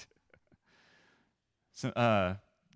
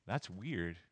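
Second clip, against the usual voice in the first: soft, low aside; understated judgment; 6 semitones lower, 11 dB quieter than usual. Acted. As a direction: drop it quietly, like the blunt truth slipping out. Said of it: "a more positive say, a way of saying".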